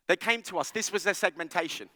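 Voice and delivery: usual speaking voice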